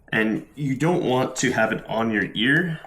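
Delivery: ordinary talk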